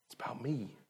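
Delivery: whispered thought. Retold or imitated. Preacher whispering the heart's selfish voice to the congregation.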